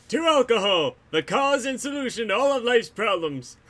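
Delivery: a hint of a dopey cartoon dad; Homer Simpson. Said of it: "As Homer Simpson said".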